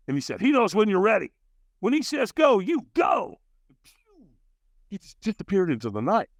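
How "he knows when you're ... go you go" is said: ordinary talk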